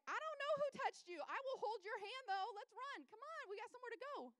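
high-pitched frantic urging, loud at first; freaked out. Acted. Jairus urging Jesus to keep moving, freaked out. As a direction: plead in a panicked high voice to hurry. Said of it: "Jairus kinda freaked out".

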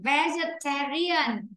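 Vegetarian drawn out slowly, stress on ta; slow model word. Shown as a demonstration. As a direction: sound out Vegetarian syllable by syllable. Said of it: "Phải té chứ không phải tơ".

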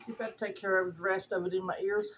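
usual speaking voice

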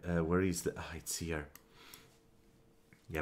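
ordinary talk, nothing marked

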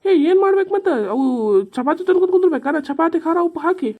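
high, loud exasperated protest; irritated retort. Acted. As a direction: argue back, pitched right up. Husband snaps back about chapati. (ಸಿಡುಕಿನ ಏರಿದ ಧ್ವನಿ)

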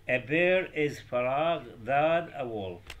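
very slow, loud reading of the exam sentence; slow dictation. Shown as a demonstration. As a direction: dictate it word by word to the class. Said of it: "زين، مقارن". (قراءة بطيئة للجملة)

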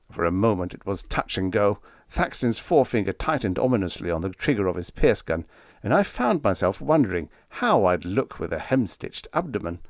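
usual speaking voice

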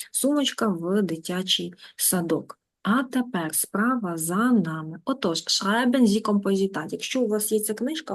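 ordinary talk

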